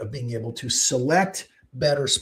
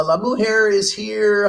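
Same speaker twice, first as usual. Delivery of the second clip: ordinary talk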